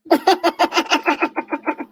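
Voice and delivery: long staccato run of laughter. Real, not acted. Co-host cracked up by the silly voice, long laugh. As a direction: laugh in a string of quick, even pulses. Laughing at co-host's put-on accent.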